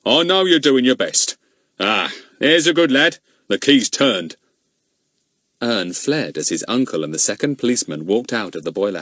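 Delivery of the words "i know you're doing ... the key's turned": honey-like tones. Acted. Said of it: "speak in honey-like tones".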